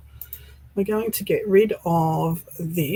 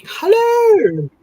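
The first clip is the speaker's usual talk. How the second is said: high, loud delighted hello; thrilled welcome; excited greeting; 12 semitones higher, 7 dB louder than usual. Real, not acted. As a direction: sing out a happy hello. Greeting friend joining stream.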